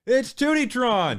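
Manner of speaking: game show announcer. Acted. Host announces contestant.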